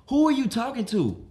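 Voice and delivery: high, loud confronting question; exasperated demand. Acted. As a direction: demand an answer from the guest. Acted confrontation with guest.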